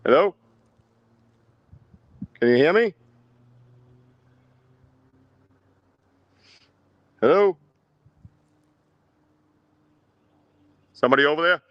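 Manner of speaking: loud, high call into the woods. Real, not acted. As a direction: shout out across the distance. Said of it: "I'm yelling over to that noise".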